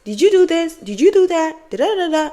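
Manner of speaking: high-pitched mock questioning; interrogating mom. Mom asking about the secret, high-pitched grilling. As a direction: pester them like a scolding mom.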